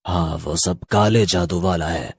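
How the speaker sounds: slow, soft ghostly voice; eerie ghost. Acted. Ghost shopkeeper telling the man whose market it is, slow and eerie. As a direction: reveal the secret with quiet menace.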